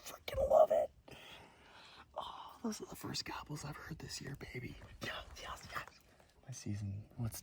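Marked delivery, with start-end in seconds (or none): 0.1-2.2 a sudden loud burst of excitement; excited burst. Real, not acted. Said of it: "I'm so excited". 2.6-6.0 hushed whisper, thrilled; excited whisper. Real, not acted. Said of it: "I'm so excited".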